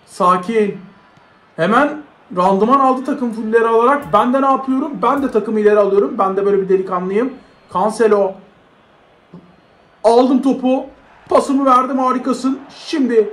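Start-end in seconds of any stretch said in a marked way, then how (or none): none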